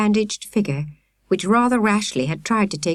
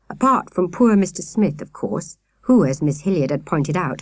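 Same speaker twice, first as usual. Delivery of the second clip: ordinary delivery, nothing marked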